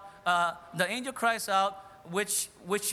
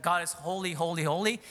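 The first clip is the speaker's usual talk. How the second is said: ordinary delivery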